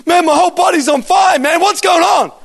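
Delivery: high-pitched shouting in mock panic; mimicked alarmed shout. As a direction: cry out like an alarmed bystander.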